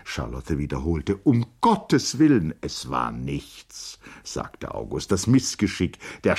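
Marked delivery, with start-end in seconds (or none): 1.2-2.5 higher, louder cry of dismay; alarmed exclamation. A narrator's reading. Charlotte reacts to the carriage accident, in an alarmed higher voice. 2.6-4.0 low, slow and softer; dismissive reassurance. A narrator's reading. August tells Charlotte the accident was nothing, low and slow.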